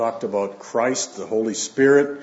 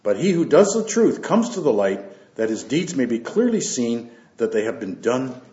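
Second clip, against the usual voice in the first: raised, measured Bible reading voice; scripture reading; 3 semitones higher, 3 dB louder than usual. Acted. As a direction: read the Gospel out to the church with weight. Reads scripture aloud to congregation.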